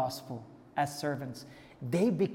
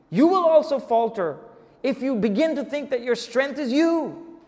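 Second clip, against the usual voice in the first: high, loud exhortation; full-force preaching; 7 semitones higher, 7 dB louder than usual. Real, not acted. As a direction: preach it with raised, ringing urgency. Preaches at full force, voicing Paul.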